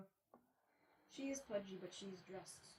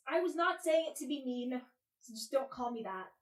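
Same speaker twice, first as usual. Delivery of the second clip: louder, insistent protest; defensive; 3 semitones higher, 9 dB louder than usual. Real, not acted. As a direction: push back hard, indignant. Defending against being called mean.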